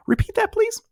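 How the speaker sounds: breathy stage whisper of the catchphrase. Acted. Teacher to writers, stage whisper. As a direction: hiss it like a secret.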